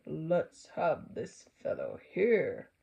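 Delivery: Santa. A narrator's reading. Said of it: "And said".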